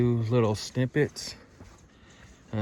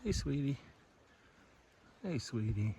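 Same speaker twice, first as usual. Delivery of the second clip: soft coaxing; 4 semitones higher, 8 dB quieter than usual. Real, not acted. Calling softly to the deer.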